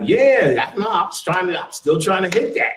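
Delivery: grandpa bragging. Retold or imitated. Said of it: "they're like".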